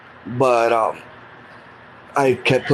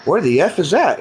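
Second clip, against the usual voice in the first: pitched-up retold disbelief, higher; baffled outburst; 6 semitones higher than usual. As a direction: shoot the question up high in shock. Waking in unknown hospital, baffled.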